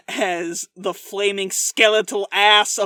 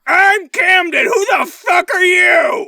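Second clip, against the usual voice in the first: loud, much higher angry shout; ghost shouting; 8 semitones higher, 8 dB louder than usual. Pretend. Camden the ghost confronting the cowboy, furious shout. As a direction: bellow it at the stranger. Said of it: "Camden, the camel-riding specter".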